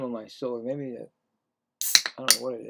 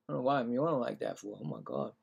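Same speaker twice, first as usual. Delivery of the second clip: normal talking voice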